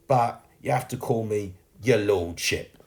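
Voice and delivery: slow, teasing mock grandeur; cheeky. A narrator's reading. Sweep teasing girl about his title, cheeky. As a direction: draw it out with a cheeky smile. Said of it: "saying with a cheeky smile".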